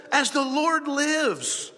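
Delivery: high, slow, drawn-out solemn vow; grand oath. Acted. Saul swearing to the witch, grand slow oath. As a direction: proclaim it as a hammed-up oath. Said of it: "Saul swore to her".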